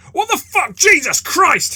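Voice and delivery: a fake Jesus yelps as his face is bitten; shocked high scream. Fake Jesus bitten by the player, screaming in shock. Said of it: "his accent abruptly dropping".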